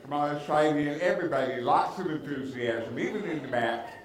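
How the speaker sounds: ordinary talk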